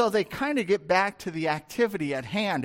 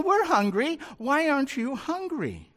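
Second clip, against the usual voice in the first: a pitched-up whine from the hungry crew; whiny hungry disciple; 6 semitones higher than usual. Acted. Hungry disciples pleading with Jesus, higher and whiny.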